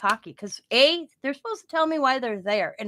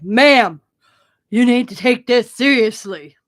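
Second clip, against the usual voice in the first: louder, firm officer's scolding; stern cop warning; 11 dB louder, slower than usual. Retold or imitated. Officer warning a laughing homeowner, loud and stern.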